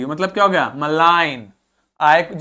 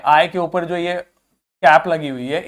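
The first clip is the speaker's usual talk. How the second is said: ordinary talk, nothing marked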